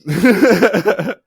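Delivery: laughing. Real, not acted. Mock-formal plea breaking into laughter.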